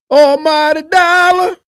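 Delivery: slow, loud hype call, pitch up high; excited shout. Acted. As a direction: announce it like a ring announcer. Hyping the money-driven singers.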